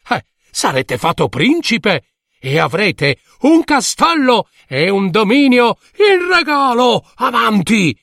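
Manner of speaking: high, jeering taunt; mocking villagers. A narrator's reading. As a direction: keep teasing him. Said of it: "la gente, ridendo, gli disse". (voce beffarda)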